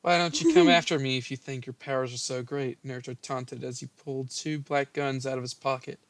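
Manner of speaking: normal talking voice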